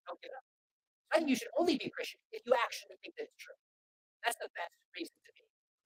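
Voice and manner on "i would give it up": quiet, sinking echo; hushed echo of the line; soft repeat. Shown as a demonstration. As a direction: let it fall away softly.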